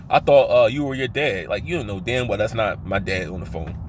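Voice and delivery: ordinary talk, nothing marked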